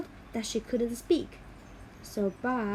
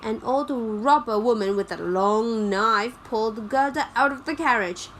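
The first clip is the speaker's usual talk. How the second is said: ordinary delivery, nothing marked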